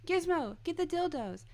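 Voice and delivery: high, sing-song calling with falling ends; high imitated voice, each phrase swooping down; Guillermo impression. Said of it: "was Guillermo saying".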